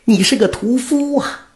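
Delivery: sudden high shocked cry. Acted. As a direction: recoil in shock, voice shooting up. Old woman learns he is butcher. (老太太大吃一惊)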